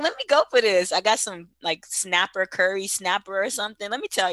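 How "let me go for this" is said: eager quote. Retold or imitated. Quoting own eager decision.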